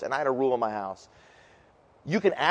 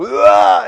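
dry heave; 6 semitones higher, 12 dB louder than usual. Retold or imitated. Acting out dry heaving kid.

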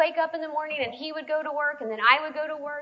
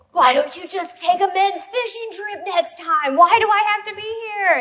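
higher and louder fed-up complaint; exasperated complaint; 7 semitones higher, 7 dB louder than usual. Retold or imitated. Wife complaining to husband after fishing trip, exasperated. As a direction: let the frustration pour out. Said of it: "I said".

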